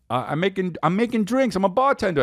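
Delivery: put-on high-pitched little-kid voice; young son. Dad quoting his son playing bartender, high child voice. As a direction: pitch it up like a small boy. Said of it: "Calvin was like".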